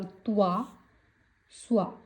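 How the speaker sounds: "त्वा, स्वा" as separate syllables, twa and swa; isolated "त्वा, स्वा", each ending in wa; French oi sound. Shown as a demonstration. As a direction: model "त्वा, स्वा" with oi said wa, pausing between. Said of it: "ओ आई है जो वो है उआ".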